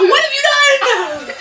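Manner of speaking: laughing and shouting high. Real, not acted. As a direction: yell it out through laughter. Friends reacting to hot sauce dare.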